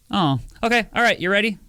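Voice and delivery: impatient friend. Retold or imitated. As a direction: rush them along, pretending to care. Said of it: "they go".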